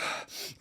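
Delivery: forceful audible breaths, no voice; big rushing breaths in and out; breathwork breathing. Shown as a demonstration. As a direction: demonstrate hard athletic breathing. Demonstrates his hard breathwork breathing.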